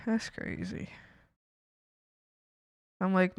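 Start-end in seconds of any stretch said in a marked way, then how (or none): 0.1-0.9 breathy whispered aside. Real, not acted. Speaker to herself about mom, whispered. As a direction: say it under your breath. Repeated quietly.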